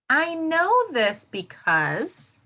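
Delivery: slow, drawn-out sentence starter with a lift; slow modelled frame. Shown as a demonstration. Teacher modelling the answer frame, slow and drawn out. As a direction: stretch it out so the kids can follow. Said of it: "This is how it will sound".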